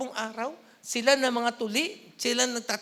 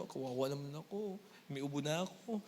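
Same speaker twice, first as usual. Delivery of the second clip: lower, softer whiny complaint; mock self-pity; 9 semitones lower, 10 dB quieter than usual. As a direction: ham up the poor sick martyr. Mocking a self-pitying sick person.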